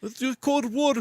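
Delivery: high, hammed-up Russian accent. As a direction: throw on a goofy Russian accent. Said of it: "that's my Russian accent".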